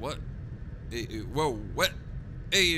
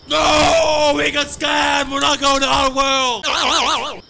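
loud dismayed shout; 9 semitones higher, 11 dB louder, much slower than usual. Real, not acted. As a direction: yell it out, outraged. Scammed on the game trip.

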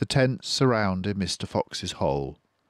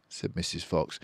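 plain reading voice

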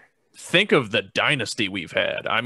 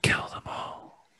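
eerie whispered urging; 9 dB quieter, slower than usual. Pretend. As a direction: whisper it close to the mic. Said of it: "When you whisper?".

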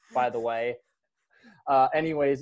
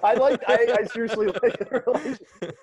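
real laughter breaking into the words; laughing; 6 semitones higher, 4 dB louder than usual. Real, not acted. Host insisting they liked it, laughing. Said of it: "why that made me laugh".

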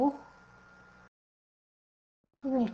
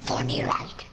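harsh unvoiced whisper; whispering villain; 11 semitones lower, slower than usual. Villain taunting the partygoer, sinister whisper.

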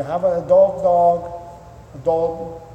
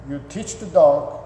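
ordinary talk, nothing marked

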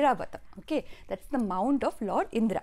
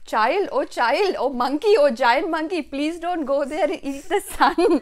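put-on loud, raised-pitch plea to a child; calling out warning; 4 semitones higher, 6 dB louder than usual. A narrator's reading. Lord Indra chases the giant monkey, calling out warnings. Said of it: "warning him several times".

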